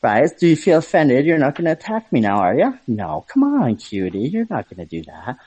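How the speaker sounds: coy, teasing camp voice with big pitch swoops. Shown as a demonstration.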